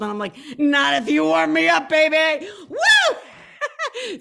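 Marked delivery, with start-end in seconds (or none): 0.6-2.5 loud, high flirty tease; flirty come-on. Retold or imitated. To the tour guide, a loud, high flirty tease. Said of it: "Sorry, I'm being bad". 2.7-4.2 high loud whoop; excited whoop. Real, not acted. Cheering her own flirt, high loud whoop. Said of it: "I'm being bad".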